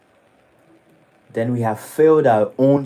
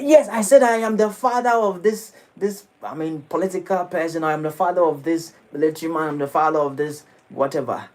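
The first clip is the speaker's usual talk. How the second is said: higher, insistent name-dropping; boastful; 5 semitones higher than usual. Retold or imitated. Proud man insisting on his father's status, boastful and high. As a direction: puff up about your important father. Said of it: "And he said".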